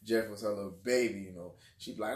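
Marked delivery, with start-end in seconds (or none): none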